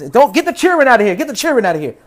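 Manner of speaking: loud high angry shooing; mock shout. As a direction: bark them off loudly, shooing. Shooing cheering tribe away.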